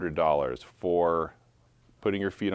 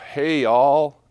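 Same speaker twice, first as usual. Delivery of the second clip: put-on loud, higher country-accent holler; badly faked country twang, called out; attempted country accent; 7 semitones higher, 9 dB louder than usual. Auctioneer opens lot three to the crowd, attempted country accent. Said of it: "I can't do a country accent".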